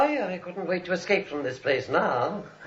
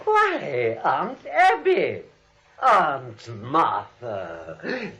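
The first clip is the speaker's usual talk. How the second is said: slow menacing greeting; 4 dB louder, much slower than usual. Acted. Jonathan greets aunts with drawn menace.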